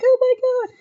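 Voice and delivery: high squeal of oh my God; starstruck. Retold or imitated. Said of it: "I'm freaking out like".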